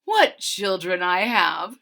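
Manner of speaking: slow, sighing mother; mother cat lamenting kittens; weary mother cat. A narrator's reading. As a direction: shake your head as a tired mother. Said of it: "she meows".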